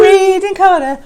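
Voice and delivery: high sing-song segment announcement; jingle announcer. Acted. Third announcement.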